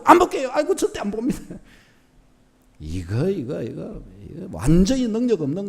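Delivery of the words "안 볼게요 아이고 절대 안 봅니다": mock cowering promise. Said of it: "깨갱 해가지고".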